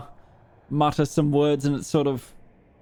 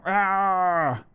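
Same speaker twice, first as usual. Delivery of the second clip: booming monster roar; huge fiery dragon roar; 5 semitones higher than usual. Shown as a demonstration. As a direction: roar to fill the hall. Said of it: "the sound you make is far louder".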